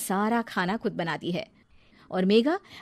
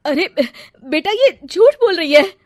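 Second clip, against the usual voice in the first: protesting; 9 semitones higher, 12 dB louder than usual. Acted. Mother pleads with son.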